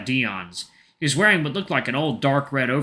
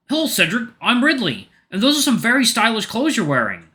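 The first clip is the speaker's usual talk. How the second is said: high, friendly bear voice; cheerful sun bear; 11 semitones higher, 5 dB louder than usual. Acted. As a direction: greet him brightly, pitched up. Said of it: "the sun bear".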